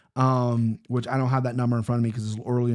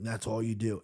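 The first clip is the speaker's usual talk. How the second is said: a much softer, gentle dad voice; quiet dad; 4 semitones lower, 9 dB quieter than usual. Retold or imitated. Father showing his son the mouse trap, softly. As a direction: say it hushed like a careful father. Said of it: "He's like".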